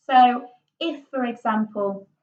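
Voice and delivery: ordinary talk, nothing marked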